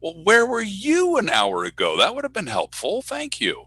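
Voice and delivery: high-pitched, exasperated mock retort; sarcastic complaint. Announcer to PR guy who corrected him late, sarcastic.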